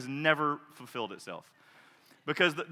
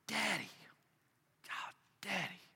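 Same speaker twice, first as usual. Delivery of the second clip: much softer, intimate invitation; hushed Jesus; 9 dB quieter than usual. Acted. Jesus gently inviting the disciples to start prayer with Daddy, hushed. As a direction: draw them close, nearly a whisper.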